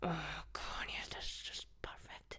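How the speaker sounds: soft gloating whisper. Acted. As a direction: purr it under the breath. Gloating aside.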